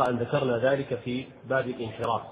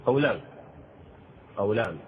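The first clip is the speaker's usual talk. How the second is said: usual speaking voice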